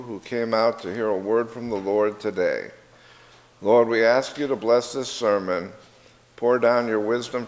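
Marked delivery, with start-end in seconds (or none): none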